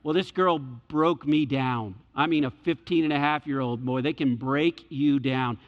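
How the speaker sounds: ordinary delivery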